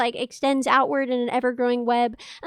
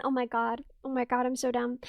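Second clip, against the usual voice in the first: softer, lower self-scolding; embarrassed mumble; 5 semitones lower, 9 dB quieter than usual. Real, not acted. Streamer mumbling after spotting her own mistake. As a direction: mumble at your own blunder.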